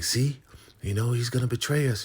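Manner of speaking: high panicky whine; scared River. Retold or imitated. River insisting to Joan, high and scared. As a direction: insist fearfully. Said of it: "And he's like".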